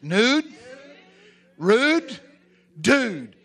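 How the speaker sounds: loud, high, word by word; emphatic call-out. Shown as a demonstration. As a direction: punch out each word for the crowd to repeat. Preacher leads congregation in repeating phrase.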